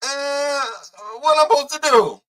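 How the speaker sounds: high, put-on clueless voice; mock helpless question. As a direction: act out not knowing what to do. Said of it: "supposed to come out here like".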